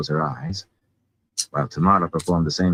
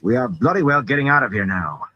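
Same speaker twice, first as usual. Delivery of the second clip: raised pitch, emphatic declaration; insistent, pitched up; firm announcement; 6 semitones higher than usual. A narrator's reading. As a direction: announce it as a decision, no debate. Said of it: "Matt announced".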